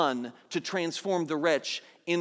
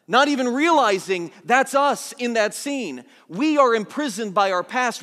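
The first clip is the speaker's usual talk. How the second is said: impassioned preaching, louder and higher; 4 semitones higher, 5 dB louder than usual. Acted. Pastor urging the congregation to see themselves, impassioned preaching. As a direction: press the point home to them.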